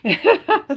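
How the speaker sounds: bursting, high laugh. Real, not acted. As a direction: laugh out loud briefly. Amused at a comment.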